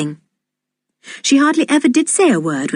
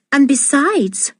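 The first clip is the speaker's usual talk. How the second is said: artful; 7 semitones higher, slower than usual. A narrator's reading. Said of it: "she added artfully".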